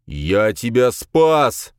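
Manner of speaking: slow, loud, drawn-out bellow; outraged roar. A narrator's reading. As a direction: roar it indignantly, stretching each word. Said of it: "взревел Хранка".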